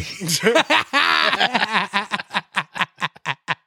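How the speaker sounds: laughing. Real, not acted. Laughing about the rude guest.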